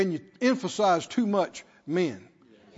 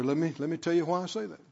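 usual speaking voice

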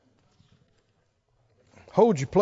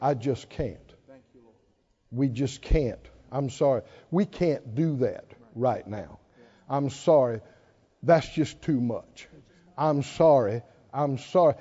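mock defeated; 5 semitones lower than usual. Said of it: "When are you gonna quit saying".

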